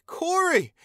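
very high, loud happy cry; joyful shout. Acted. Taion greeting his friend Corey, joyful shout. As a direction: call out with joy at the reunion.

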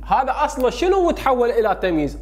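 ordinary talk, nothing marked

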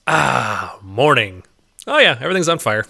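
ordinary delivery

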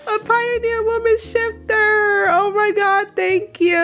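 high, squealed delight; real high-pitched joy; thrilled gratitude. Real, not acted. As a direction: squeal your thanks, overjoyed. Thanks sender for wished-for gift.

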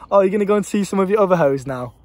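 put-on playful teasing girlfriend. Girlfriend teases him about other hoes, playful mock jab. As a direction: tease him lightly, not mean. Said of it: "a playful, teasy way".

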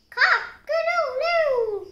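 high, loud crowing call; rooster's crow. A narrator's reading. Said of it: "called Rooster".

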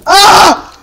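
high, short shocked scream; startled yelp. Real, not acted. Player hit by a monster, startled yelp. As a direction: yelp out in sudden alarm. Attacked in game.

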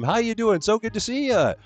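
high, sing-song hellos to each viewer; cheery stream greeting. Real, not acted. Streamer welcoming chat members by name, high and sing-song.

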